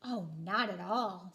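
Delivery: low, slow easygoing pebbles. A narrator's reading. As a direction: answer calmly as the pebbles. Pebbles reply.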